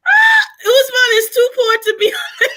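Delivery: loud, high, laughing shout; laughing yell; laughing outburst. Real, not acted. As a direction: burst out laughing at him.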